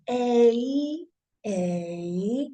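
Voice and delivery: "A" said slowly, the mouth moving visibly; slow, deliberate teaching model of "A"; slow demonstration. Shown as a demonstration. Teacher showing the mouth movement, slowly. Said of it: "Look at my mouth".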